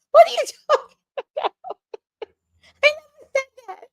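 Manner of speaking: high, fast disbelief; high disbelieving protest; incredulous denial. Real, not acted. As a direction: protest in shock. Denying in disbelief.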